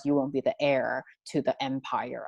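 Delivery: ordinary delivery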